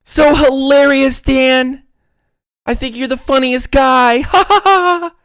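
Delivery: high, sarcastic fake laughter and praise; mock them with a high sarcastic cackle; sarcastic fans. Georgia fans mocking Dan Quinn with high sarcasm. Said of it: "all the Georgia fans were like".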